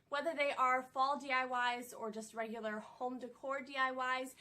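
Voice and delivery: ordinary talk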